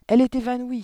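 high, loud alarmed cry; teacher crying out over the fainted headmistress; exclaiming. A narrator's reading. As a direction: call it out in alarm. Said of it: "s'écria-t-elle".

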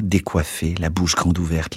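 ordinary delivery

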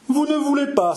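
a nobleman exploding at an insult; angry outcry. A narrator's reading. Maugiron shouts at the insolent chevalier in sudden anger. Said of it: "s'écria Maugiron en pâlissant de colère".

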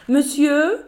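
hospital operator greeting like a flight attendant; air hostess voice. Acted. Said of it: "parlait comme une hôtesse de l'air".

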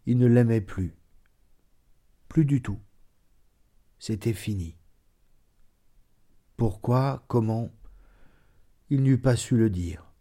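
ordinary delivery, nothing marked

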